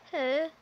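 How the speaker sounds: loud demonstrated purr. Shown as a demonstration. Showing viewers how she purrs as a dragon, loud purr. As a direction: roll out a loud, rising purr. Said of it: "so this is how I".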